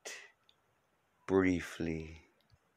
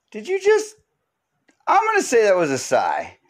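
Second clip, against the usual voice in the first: mock shocked friend; 12 semitones higher, 3 dB louder than usual. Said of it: "It's one of those kinda like".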